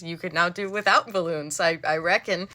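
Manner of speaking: ordinary talk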